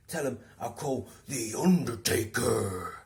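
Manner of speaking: deadpan absurd warning; imitating Steve. Steve threatening the harasser, slow mock threat. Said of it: "this is what he did".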